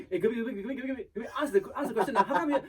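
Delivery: high, loud, pestering director voice; panicky director. Retold or imitated. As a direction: imitate a frantic director in the earpiece. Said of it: "It's panic voice in my head".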